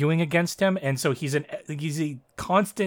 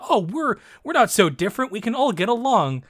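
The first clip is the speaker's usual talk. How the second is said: higher, cloying singsong; mock saccharine; 7 semitones higher than usual. Imitating the show's half-assed racism moral, mock saccharine. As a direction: parody a sugary moral.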